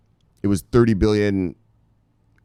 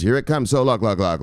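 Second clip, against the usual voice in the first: ordinary delivery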